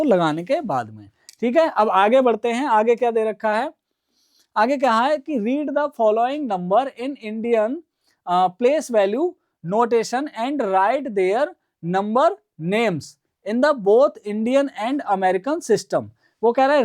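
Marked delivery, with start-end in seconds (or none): 5.4-16.1 slow, word by word reading of the question; slow reading aloud. Shown as a demonstration. Teacher reading the textbook question to students, slowly. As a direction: spell the question out slowly for the class.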